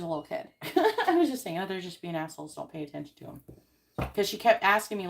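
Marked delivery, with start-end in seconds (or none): 1.6-3.4 softer, half under the breath, fading away; quiet aside to a child. Retold or imitated. Mother quietly reassures her daughter about rude staff, softly. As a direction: murmur it so only your kid hears. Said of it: "quietly to your friend".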